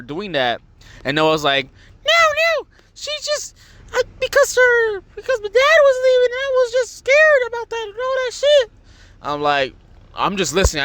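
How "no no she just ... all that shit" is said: very high squeaky mock-child voice; whiny little sister. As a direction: imitate a little sister pleading her excuse. Little sister's excuse acted out.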